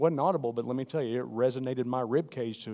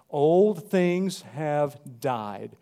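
slow, much louder, drawn out; booming proclamation; 3 semitones higher, 8 dB louder, much slower than usual. Acted. Preacher voicing God to congregation, booming slow. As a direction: proclaim it with full force.

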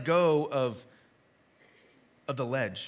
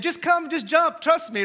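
high-pitched coaxing plea, a little louder; urging mom; 10 semitones higher, 4 dB louder than usual. Acted. Moms to scared kids at the pool, high urgent coaxing. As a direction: urge a frightened child to jump, voice raised high.

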